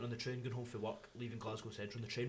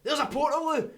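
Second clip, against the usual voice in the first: high, loud relieved cry; excited shout; 13 dB louder than usual. Retold or imitated. Desperate to pee, spotting a toilet from the bus, shouting. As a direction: yell it out like you just found salvation.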